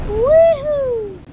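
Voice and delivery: high, loud joyful whoop; excited whoop. Real, not acted. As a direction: whoop with delight. Gleeful whoop while playing.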